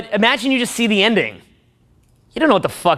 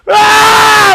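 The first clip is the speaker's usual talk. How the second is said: much louder, much higher fighting shout; battle yell; 10 dB louder than usual. Acted. Acting out Neo's blow with a loud battle yell. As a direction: belt it like landing a punch.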